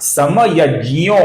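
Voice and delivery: समयज्ञो slowly, ज्ञ as "jy", long O; pronunciation model. Shown as a demonstration. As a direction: draw out समयज्ञो with j plus y. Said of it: "ऐसा इसका उच्चारण होगा".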